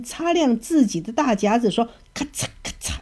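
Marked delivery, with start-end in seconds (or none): 2.1-3.0 claw snip sound. A narrator's reading. Crab snipping claws.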